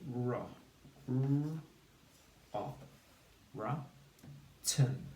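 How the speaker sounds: "ra" split into long r and short o, then "Ten"; sounded out. Shown as a demonstration. Teacher builds the word with pupils, sounded out. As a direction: break "rotten" into "Ra" and "Ten", pausing between pieces. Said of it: "and we say".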